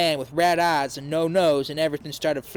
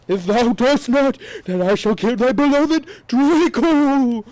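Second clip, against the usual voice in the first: high, loud, slow evil voice; slow, louder and higher sinister decree; villain yell; 8 semitones higher, 7 dB louder than usual. A narrator's reading. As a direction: boom out a dark lord's command. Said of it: "he answered cruelly".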